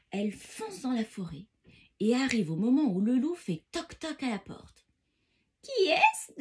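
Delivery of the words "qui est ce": high, loud little question; grandmother's voice. A narrator's reading. Grandmother answering a knock at the door, high-voiced. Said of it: "demande Mère Grand".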